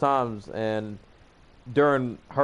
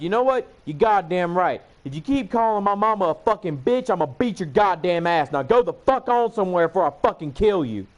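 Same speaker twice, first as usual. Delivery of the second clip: higher, louder retold threat; angry retort; 6 semitones higher, 3 dB louder than usual. Him firing back at the woman who insulted him, angry retort. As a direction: re-enact a heated telling-off.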